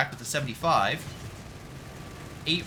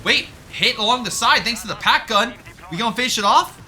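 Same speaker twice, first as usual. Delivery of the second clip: excited; 8 semitones higher, 8 dB louder than usual. Real, not acted. Caster hyped by the fight.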